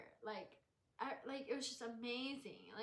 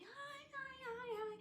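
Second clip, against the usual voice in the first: tiny high-pitched hi's; high, rapid-fire retold greeting; flustered greetings; 14 semitones higher, 4 dB quieter than usual. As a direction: squeal hello to everyone at once. Reenacts greeting unexpected crowd.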